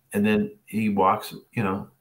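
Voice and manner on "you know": usual speaking voice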